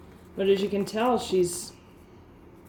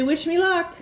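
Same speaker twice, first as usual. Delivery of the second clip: much higher, louder put-on little bird voice; voicing the hen; 10 semitones higher, 6 dB louder than usual. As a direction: speak for the chicken, cute and squeaky.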